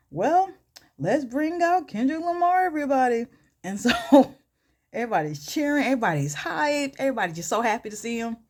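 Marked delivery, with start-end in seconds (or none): 0.1-3.3 high, drawn-up talk-show announcer; show host introduction. Retold or imitated. Host brings out the guest for the studio audience, announcing. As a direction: announce the star guest with raised pitch.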